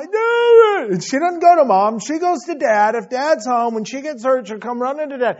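excited build. Real, not acted. Preacher building excitedly about daughter.

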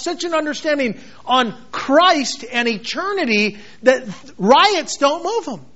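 ordinary talk, nothing marked

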